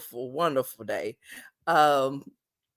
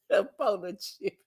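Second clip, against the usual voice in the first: normal talking voice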